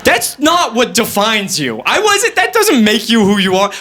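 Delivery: usual speaking voice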